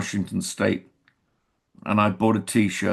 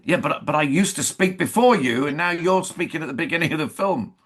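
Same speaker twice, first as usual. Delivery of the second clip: high-pitched imitation of a complaining colleague; protesting; 7 semitones higher than usual. Co-star objects to actor speaking first in film, protesting. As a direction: object in a raised pitch, picking up pace.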